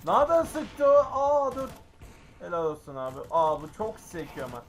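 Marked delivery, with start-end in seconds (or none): 0.0-1.7 startled shout. Real, not acted. Gamer startled by hidden shooter.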